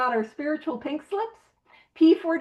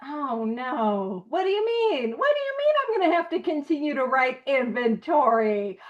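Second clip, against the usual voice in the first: mock whiny complaint.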